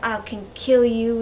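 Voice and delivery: bad mock Southern accent. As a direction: threat in a hammed-up Southern accent. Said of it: "I just did a southern accent for her".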